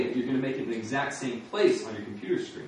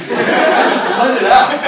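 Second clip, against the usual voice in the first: loud, slow laughing call; a big laughing invitation; laughing loudly; 12 semitones higher, 16 dB louder, much slower than usual. Real, not acted. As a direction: laugh out loud and urge them on. Crowd laughing along.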